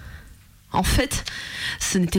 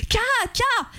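very high answering mock shout; retold shout; 14 semitones higher than usual. Little man answering her shout from the stage, very high mock shout. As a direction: toss the word back up high. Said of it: "a continué".

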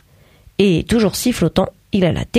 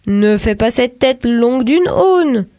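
slow, higher, lightly voiced father teasing his son; a lightly played dad, slow and easy; father's voice; 5 semitones higher, slower than usual. A narrator's reading. Father scolds son at breakfast.

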